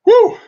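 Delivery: loud high yelp of heat; high whoo of heat. Real, not acted. Burning from a raw pepper.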